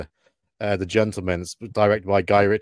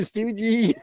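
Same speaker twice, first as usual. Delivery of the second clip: very high Scouse squeal; high-pitched, half-hearted Scouse scream; mock Scouse scream; 13 semitones higher than usual. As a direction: imitate a Scouse fan crying out for a hero. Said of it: "And they scream".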